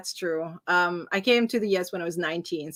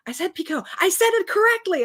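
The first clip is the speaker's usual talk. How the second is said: high-pitched delighted self-quote; excited; 10 semitones higher than usual. Retold or imitated. Learner retelling getting the word right at last, excited. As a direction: burst out proudly, pitch leaping up. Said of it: "I was, like, so excited".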